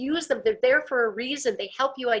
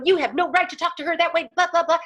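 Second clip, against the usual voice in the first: high, raised-voice mock scolding; acted aggressor; 6 semitones higher, 4 dB louder than usual. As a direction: tell them off, trailing into angry blahs. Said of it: "If I come in as the aggressor".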